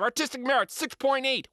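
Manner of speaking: plain reading voice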